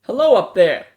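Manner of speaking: loud call up to the people above. A narrator's reading. Seth greeting strangers from below, loud call. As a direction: call out loudly, reaching up there. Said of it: "Seth called out".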